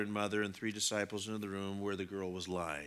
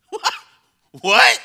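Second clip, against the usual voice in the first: very high, loud yelp of disbelief; incredulous outburst; 14 semitones higher, 21 dB louder than usual. Acted. Parents react to Jesus saying tell nobody, incredulous. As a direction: blurt it out, astonished.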